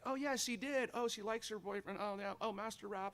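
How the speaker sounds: high, gushing mock excitement; excited gossip. A mom gushing over her daughter's texts, in mock excitement. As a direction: gush like a thrilled mom reading texts.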